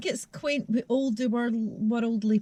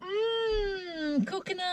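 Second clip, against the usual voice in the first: high soft delighted mmm; 11 semitones higher, 6 dB quieter, much slower than usual. Real, not acted. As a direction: coo at the scent, high and soft. Smelling the lip balm.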